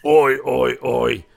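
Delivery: imitated gruff oi. Recapping the first wrestler's yell, loud imitation. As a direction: bellow the ois in your own register. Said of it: "From going".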